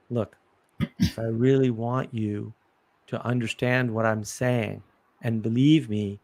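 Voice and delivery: low, slow and even, no melody. Shown as a demonstration. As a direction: drop the voice and flatten it out. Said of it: "I can lower my voice and go monotone".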